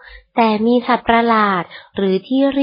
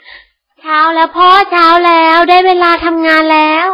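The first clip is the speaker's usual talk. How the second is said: higher, louder, slow bug mum calling; mother bug waking; 6 semitones higher, 5 dB louder, slower than usual. Acted. แม่แมงปลุกพ่อแมงตอนเช้า, high loud wake-up call. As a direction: call him awake like a cheery bug mother. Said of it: "แม่แมงปลุกพ่อแมง".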